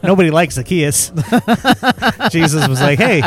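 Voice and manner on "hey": usual speaking voice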